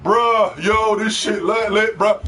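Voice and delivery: mock everyday bro talk; lightly exaggerated slangy bro; hyped bro slang. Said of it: "They talk like that on a daily fucking basis".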